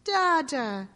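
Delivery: high, loud happy call; baby calling with joy. A narrator's reading. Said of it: "he called".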